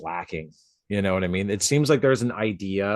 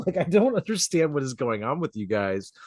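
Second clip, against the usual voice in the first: ordinary talk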